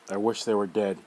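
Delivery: put-on boy swearing he wants his family dead, growing angrier. Repeats the wish, louder.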